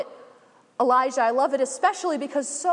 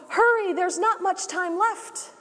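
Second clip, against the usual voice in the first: high, urgent shout over the rotor noise; 7 semitones higher than usual. Acted. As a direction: yell down from the helicopter, urgent. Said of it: "they shout down".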